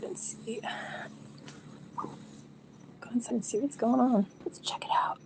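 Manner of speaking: hushed sneaking whisper. Real, not acted. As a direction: whisper while creeping inside. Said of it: "my quiet voice".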